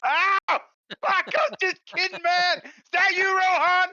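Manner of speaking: high, loud pained yell; pained shout. Acted. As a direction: cry out after being shot. Character shot by crossbow bolt.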